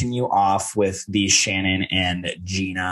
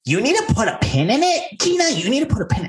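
very high-pitched mock nagging; Shannon impression. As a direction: imitate a scolding housewife, voice shooting up high. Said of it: "every single time Shannon goes".

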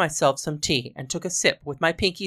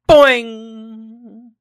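loud, high springy boing; high twanging launch noise; catapult boing; 6 semitones higher, 11 dB louder than usual. A narrator's reading. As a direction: let the catapult fly with a big boing.

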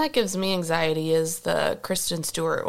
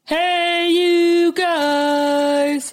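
high, loud, long-held greeting; drawn-out call; 9 semitones higher, 5 dB louder, much slower than usual. Acted. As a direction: holler it out, stretching every word.

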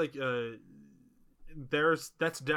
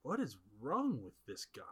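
lower, softer baffled aside; baffled quote; 4 semitones lower, 5 dB quieter than usual. Retold or imitated. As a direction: mutter it, puzzled. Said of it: "is just like".

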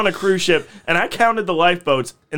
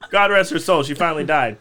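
ordinary delivery

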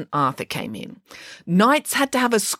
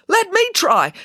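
high, louder eager call; eager cry; 12 semitones higher, 4 dB louder than usual. A narrator's reading. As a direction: jump in eagerly. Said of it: "cried an athletic young knight".

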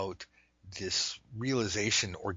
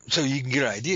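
ordinary delivery

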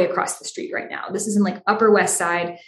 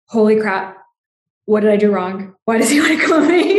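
mock panic; 4 dB louder than usual. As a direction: act out a sudden fright, amused at yourself. Panicked reaction to email.

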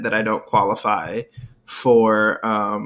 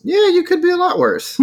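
teasing; 4 dB louder than usual. Real, not acted. Teasing agreement, pitch jumps.

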